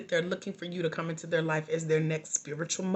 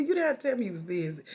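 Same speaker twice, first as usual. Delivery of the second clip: ordinary talk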